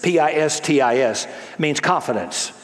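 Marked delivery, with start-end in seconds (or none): 0.0-1.3 P I S T I S, each letter said separately; spelled out letter by letter. Shown as a demonstration. Preacher spells P I S T I S for the congregation. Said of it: "it's pistis".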